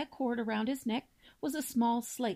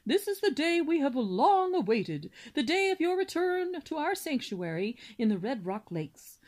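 lightly voiced solemn father, louder; deep, resonant voice; 5 semitones higher, 4 dB louder than usual. Father swan formally welcomes his son, grandly solemn. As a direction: address your son with ceremony. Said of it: "in his deep, resonant voice".

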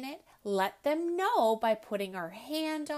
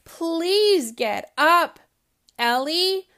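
slow, louder coaxing plea; pleading father; 6 semitones higher, 12 dB louder, slower than usual. Acted. Father begging his sulking daughter out of bed, drawn-out plea. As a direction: drag it out, begging her to get up.

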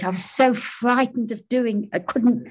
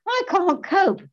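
very high mock wail; hammed-up high-pitched complaint; pitching old voice; 12 semitones higher, slower than usual. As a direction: pitch it way up, playing someone who can't cope. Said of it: "pitching, like".

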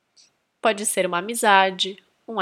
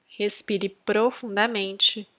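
guided meditation; 7 dB quieter than usual. Acted. Host leading listeners to attract a soul mate, guided meditation. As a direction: guide them gently inward, unhurried.

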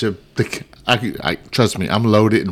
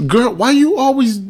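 mock exasperated; 9 semitones higher than usual. Husband mimics himself scolding wife over lottery. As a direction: whine it up high, fed up.

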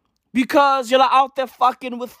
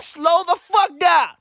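slow, higher, half-crying yell; mock shout; 7 semitones higher than usual. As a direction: drag out a pleading shout to make her calm down. Crowd yelling at the heckler.

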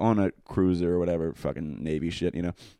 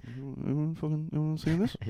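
high, hushed mock amazement; hushed disbelief; 8 semitones higher, 6 dB quieter than usual. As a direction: play a stunned guy checking around, softly. Imagined recipient reacts to nude picture.

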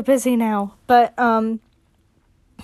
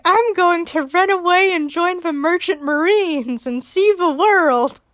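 put-on high, eager little boy; a little boy announcing his grand plan, pitched high; kid Spencer voice; 7 semitones higher than usual. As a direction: play the eight-year-old dreaming big. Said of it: "He's like".

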